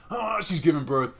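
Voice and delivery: wife in labour rounding on her husband in mock fury; mock furious wife. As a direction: snap at him mid-labour, half joking. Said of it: "His wife was like".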